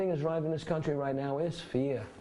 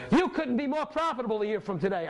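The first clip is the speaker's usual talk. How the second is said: higher, eager pitch to the class; excited promise; 7 semitones higher than usual. Real, not acted. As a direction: sell them the future.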